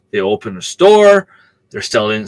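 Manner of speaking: ordinary talk, nothing marked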